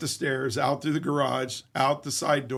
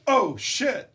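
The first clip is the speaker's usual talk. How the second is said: sudden high startled curse; startled oh shit; 8 semitones higher than usual. Retold or imitated. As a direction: caught off guard, jump up in pitch. Confronting intruder in office.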